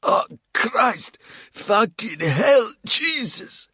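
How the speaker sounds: high, loud, slow howl of pain; howling in agony. A narrator's reading. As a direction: scream through the torture. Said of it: "Coles howled in agony".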